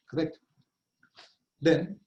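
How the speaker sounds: ordinary talk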